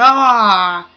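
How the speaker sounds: loud yelp of alarm; sudden loud scream; startled scream. Real, not acted. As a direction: cry out as you get swallowed. Getting eaten in game.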